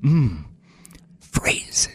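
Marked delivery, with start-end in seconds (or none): 1.2-1.9 hushed, secretive whisper. Acted. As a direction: breathe it like a secret. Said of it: "why did you whisper that part?".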